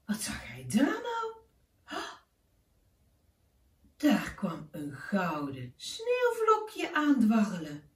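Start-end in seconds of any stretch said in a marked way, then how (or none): 0.0-2.2 higher, rising amazement; surprised wonder. A narrator's reading. Narrator spots the golden snowflake for the children, surprised and higher. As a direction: gasp at what appears.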